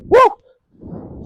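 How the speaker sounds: high unvoiced whoop of joy; excited whoop. Real, not acted. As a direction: whoop out in delight. Cheering a great finish.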